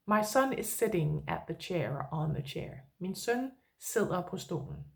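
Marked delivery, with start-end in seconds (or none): 3.0-4.8 softer, lower model sentence, Min søn sidder på stolen said gently; quiet, lower repeat of the Danish example. Shown as a demonstration. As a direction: say Min søn sidder på stolen again, softly, for the learner to copy. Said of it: "So I could say".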